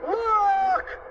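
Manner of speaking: very high, stretched call; alarmed warning. A narrator's reading. Pointing at danger in story.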